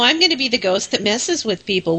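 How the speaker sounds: ordinary talk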